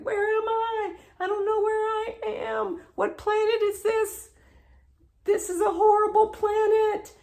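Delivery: very high-pitched, acted-out distress; frantic retold alarm, voice way up; panicked. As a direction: wail it in lost bewilderment. Acted panic on alien planet.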